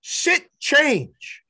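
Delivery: high yelled call across the field; coach yelling signals. Retold or imitated. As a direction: bark the code at the batter. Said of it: "He yells to the batter".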